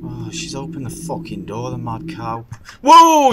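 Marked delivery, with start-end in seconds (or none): 2.8-3.3 very high, loud scared yelp; shocked yell. Real, not acted. Gamer yelping as the door slams, high and loud. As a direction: leap out of your skin.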